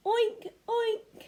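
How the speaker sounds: high, loud oink oink; pig oink. Shown as a demonstration. Teacher leads children in saying oink like a pig. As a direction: model a pig's oink, high and bright. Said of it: "Can you say oink like a pig".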